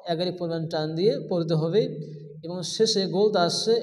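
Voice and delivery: ordinary talk, nothing marked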